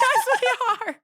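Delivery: very high mock wail; retold contradiction. Insisting to the friend they are fighting, mock wailing. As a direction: imitate a wailed comeback in a squabble. Acted quarrel before guards.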